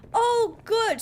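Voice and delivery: high, exasperated mock delight; sarcastic exclamation. Real, not acted. Player learning the worm can swim, sarcastic cry. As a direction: burst out in sarcastic relief.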